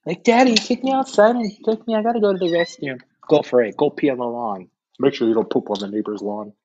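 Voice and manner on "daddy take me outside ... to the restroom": put-on high, childlike begging; dog as child. Dog begs owner to go outside, high child voice. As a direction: voice a dog pleading with daddy. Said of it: "bark at me like I'm your dad".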